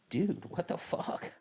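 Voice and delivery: high, slow, breathy stunned gasp of disbelief; shocked disbelief. Retold or imitated. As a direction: let it fall out of you in shock. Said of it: "And I was like".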